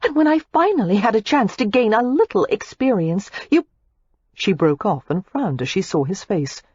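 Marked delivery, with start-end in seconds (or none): 0.0-3.6 put-on higher, irate young woman; Daisy, irate. A narrator's reading. Daisy complains irately to Matthew. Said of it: "Daisy was saying irately".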